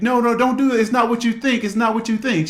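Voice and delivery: high, loud, desperate pleading; husband begging his wife to believe him, high and desperate. Retold or imitated. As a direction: beg her not to do it. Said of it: "trying to explain and beg".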